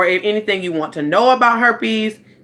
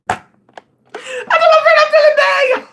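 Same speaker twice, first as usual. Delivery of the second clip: high, slow laughing mock wail; laugh-shout the complaint; laughing high squeal; 5 dB louder, slower than usual. Real, not acted. Vlogger laughs while mimicking telling her friend she feels bad.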